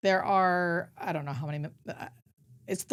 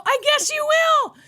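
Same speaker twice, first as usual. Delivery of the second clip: gentle high reply; 8 dB louder, slower than usual. Retold or imitated. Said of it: "And I go".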